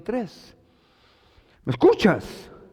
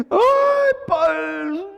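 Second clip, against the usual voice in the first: high wailing cry of a weepy believer; mock wail; 11 semitones higher than usual. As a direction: wail it out like a crybaby. Mocking crying believer. (lamento burlón y agudo)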